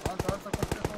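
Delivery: loud urgent push forward; shouting orders. Real, not acted. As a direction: yell them forward. Leader urging team forward.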